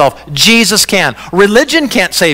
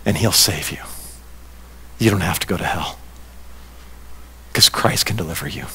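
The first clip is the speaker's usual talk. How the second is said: low, soft, earnest close; hushed assurance; 10 semitones lower, 11 dB quieter, faster than usual. Real, not acted. Preacher telling listeners they will be saved, hushed. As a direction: reassure them softly, leaving long pauses.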